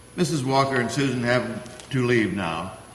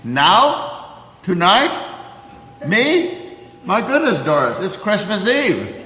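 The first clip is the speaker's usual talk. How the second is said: high, louder flustered disbelief; delighted surprise; 8 semitones higher, 5 dB louder, slower than usual. Acted. Old man invited to Christmas Eve dinner, delighted surprise. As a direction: bubble over with surprised delight.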